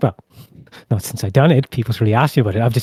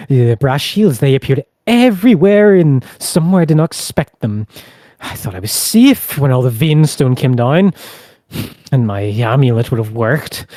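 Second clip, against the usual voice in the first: agitated prisoner voice; 4 semitones higher, 6 dB louder than usual. Acted. Prisoner pleads his case.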